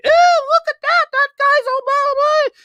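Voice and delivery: hammed-up high-pitched caricature; mocking Soups Kid. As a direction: squeal it like a silly kid. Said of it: "Or the Soups Kid".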